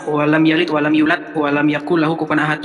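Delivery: low, even Quran recitation; beginner's recitation. Retold or imitated. Man showing how he first recited Al-Ikhlas, plain low recitation. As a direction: recite it low and unadorned, like a learner. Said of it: "waktu itu yang saya baca begini".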